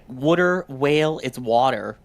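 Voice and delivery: "water" said as wudder, North Jersey style. Shown as a demonstration. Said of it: "it hurts my jaw to say it like that".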